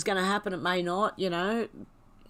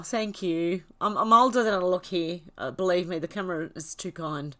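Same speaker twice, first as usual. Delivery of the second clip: normal talking voice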